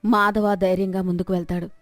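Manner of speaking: plain reading voice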